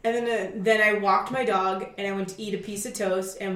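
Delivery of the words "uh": ordinary talk, nothing marked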